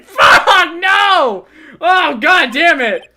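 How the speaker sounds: high, loud cry of horror; anguished shout. Acted. Attorney screaming at the lawyer's boast, anguished shout. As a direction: yell as if it hurts to hear.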